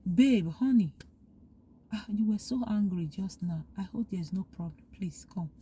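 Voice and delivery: soft, hushed pet-name coaxing to wife; tender coaxing. Acted. Husband softly calling his calmed wife over to talk, tender and hushed. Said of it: "call her".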